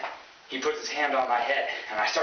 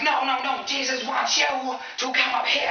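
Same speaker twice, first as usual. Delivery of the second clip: high, stretched-out revivalist cry; hammed-up fervent preacher voice; 4 dB louder, slower than usual. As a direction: insist loudly from the pulpit. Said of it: "And he's like".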